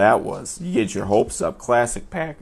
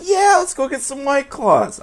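much higher, louder goofy enthusiasm; 7 dB louder than usual. Retold or imitated. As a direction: squeak it out like a giddy kid. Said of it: "And I'm like".

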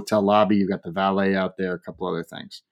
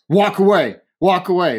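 high, louder mimicked order; warning off; 8 semitones higher, 4 dB louder than usual. Husband to departing diner, mock commanding. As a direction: send him off with a repeated command.

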